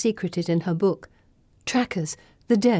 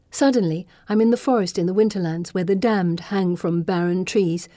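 plain reading voice

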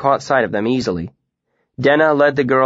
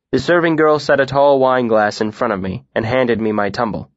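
ordinary delivery

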